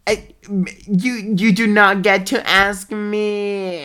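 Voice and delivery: loud, slow, indignant; you do not get to ask me. Real, not acted. Indignant retort.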